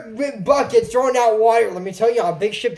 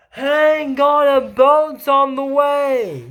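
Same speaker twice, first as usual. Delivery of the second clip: mild mock yell; 3 semitones higher, much slower than usual. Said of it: "Elaine yells out".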